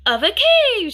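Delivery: high, loud shout back. A narrator's reading. Grandfather answering the boy, loud high shout. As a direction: shout it out with excitement. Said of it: "shouted Grandfather".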